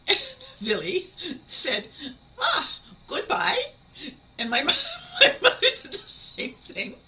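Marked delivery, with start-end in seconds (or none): 2.4-3.7 high, slow, louder mock German greeting; delighted imitated German accent, rising. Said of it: "That's the best German accent I've got".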